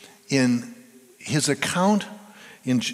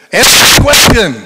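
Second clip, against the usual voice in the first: much higher, much louder divine command; booming God voice; 10 semitones higher, 16 dB louder than usual. Acted. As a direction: thunder it down from heaven. God repeats the call, booming.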